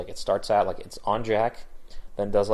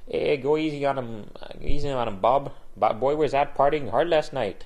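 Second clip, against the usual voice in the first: put-on higher-pitched Spanish-accented barista; 6 semitones higher than usual. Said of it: "a Spanish accent".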